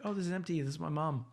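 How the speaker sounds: put-on a nervous boy quickly explaining himself; nervous kid. Kid explaining the jar to the clerk, nervous and high. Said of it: "I was like".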